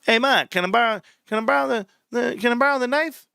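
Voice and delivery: hint of a mobster asking his ma; movie mobster son. Quoting Goodfellas to tease Freddie, movie mobster son. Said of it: "'Cause I've seen Goodfellas".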